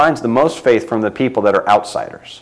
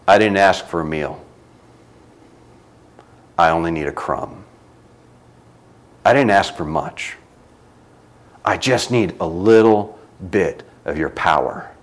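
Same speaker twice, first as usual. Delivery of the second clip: lower, softer, with long pauses; humble soft reply; 7 semitones lower, 6 dB quieter than usual. Acted. Canaanite woman humbly answering Jesus, soft and low. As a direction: ask gently, barely daring.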